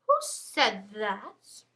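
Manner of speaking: slow, drawn-out mock interrogation; suspicious teacher. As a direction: imitate a teacher hunting the culprit, very slowly. Teacher's challenge to class acted out.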